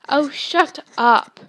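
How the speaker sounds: slow, loud exasperated shut up; the prefect fed up with his brothers' teasing; irritated retort. Acted. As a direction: stretch it out, fed up with their teasing. Percy snaps at teasing twins.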